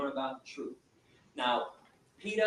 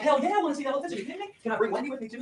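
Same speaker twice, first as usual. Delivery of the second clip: much higher, eager and loud; thrilled, can't believe the offer; excited; 9 semitones higher, 6 dB louder, faster than usual. Retold or imitated. Young man answering his stepdad, thrilled and eager. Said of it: "I'm like".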